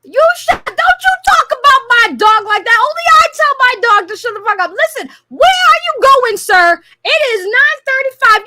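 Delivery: loud, high-pitched telling-off; fired-up, forceful rebuke; indignant scolding. Real, not acted. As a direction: snap back loudly at a rude caller. Defends her dog against caller.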